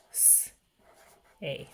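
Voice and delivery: "S, a" as a soft hiss, a pause, then "ay"; stretched-out sounds. Shown as a demonstration. Teacher modelling for a child writing the word, sounded out. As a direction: sound out "S, a" in two separate pieces. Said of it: "stretch out that word".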